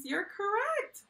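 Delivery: high, bright-eyed "you're correct", then a loud "Awesome job"; excited praise. Acted. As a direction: cheer the child on. Teacher praising correct answer.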